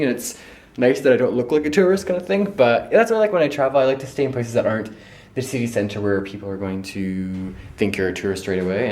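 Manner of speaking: ordinary talk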